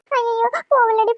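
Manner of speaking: normal talking voice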